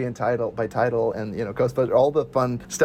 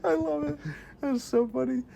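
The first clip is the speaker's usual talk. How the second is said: ordinary talk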